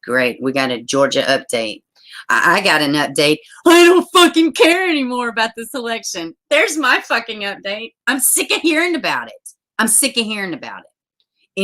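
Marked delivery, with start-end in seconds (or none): 3.7-11.6 high-pitched, fed-up outburst; exasperated rant. Real, not acted. Giving viewers an update on the election, exasperated rant.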